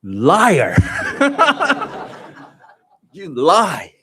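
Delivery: loud, scoffing "liar"; retold indignant shout; mock accusation. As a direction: call her a liar out loud, half joking. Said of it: "And I said".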